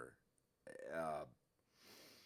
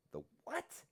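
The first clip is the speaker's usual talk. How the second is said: much higher, puzzled squeak of disbelief; a stunned, incredulous question; baffled. Retold or imitated. Guard's baffled reaction.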